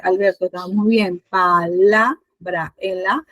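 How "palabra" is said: PALABRA slowly split, leaning on the middle LA; drawn-out palabra with weight on second syllable; stress on LA. Shown as a demonstration. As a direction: show the stressed syllable in pa-LA-bra. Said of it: "recae la mayor fuerza de voz".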